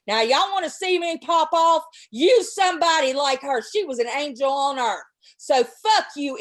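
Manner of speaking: loud furious tirade at a troll; real rage, loud and cutting; angry rant. Real, not acted. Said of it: "there's my little rant".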